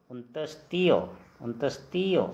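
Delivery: उनतीस तियो said as an Assamese letter name, clearly; teacher models उनतीस तियो for the class. Shown as a demonstration. Teacher models Assamese pronunciation for learners. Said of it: "असमिया में क्या बोलेंगे?".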